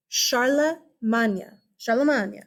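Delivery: Charlemagne as SHAR-luh-mahn-yuh, slow; Old French pronunciation. Shown as a demonstration. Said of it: "So it's not modern French".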